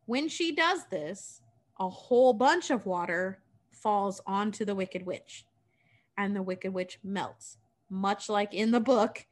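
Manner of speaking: plain reading voice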